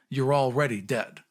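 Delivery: ordinary talk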